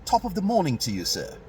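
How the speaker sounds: a light send-up of a proper Englishman; British accent. Retold or imitated. Imitating how a British person greets you, in a British accent. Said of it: "a British person may say".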